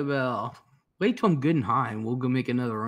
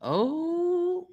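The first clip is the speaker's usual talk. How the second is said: surprised oh. Real, not acted. Reacting to a guest's outburst.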